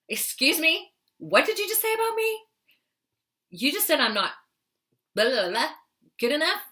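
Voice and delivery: high, rising disbelief, acted out; indignant challenge. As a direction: play it affronted, pitch climbing on each question. Confronts inner critic aloud while driving.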